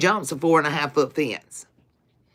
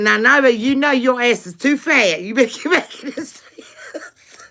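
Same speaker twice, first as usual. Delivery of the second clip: mock scolding; 5 semitones higher than usual. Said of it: "She said".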